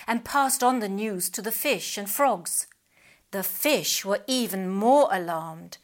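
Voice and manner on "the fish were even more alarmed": plain reading voice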